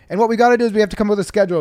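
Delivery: the brother setting up the bit, rattled off very fast; rushed brother. Retold or imitated. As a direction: rattle it off like a kid selling a plan. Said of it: "and he'd be like".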